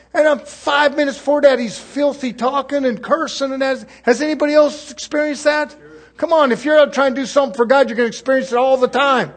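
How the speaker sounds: rally the congregation to agree; preacher urging the church to recognize hypocrisy, fervent; fervent preaching. Acted.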